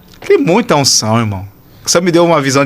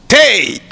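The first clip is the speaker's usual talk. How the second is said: high, sharp burst; mimicked high-pitched shout; imitated sudden cry; 8 semitones higher than usual. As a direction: fire it out like a command. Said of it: "apontou e fez assim".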